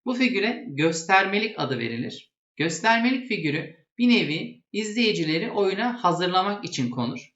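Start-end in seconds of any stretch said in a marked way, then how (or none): none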